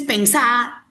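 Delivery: pensá with a drawn-out, stressed final A; stress on final syllable. Shown as a demonstration. Teacher showing Argentine speech, pensá stressed on the final A. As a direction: lean long on the last A of pensá. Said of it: "porque es aguda y termina en vocal".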